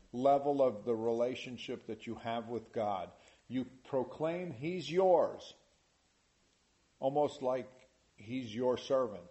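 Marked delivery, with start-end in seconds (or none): none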